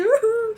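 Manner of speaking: loud, high mock wail; hammed-up boo-hoo howl; imitated crying wail. Patient showing viewers the crying on the table, mock wail.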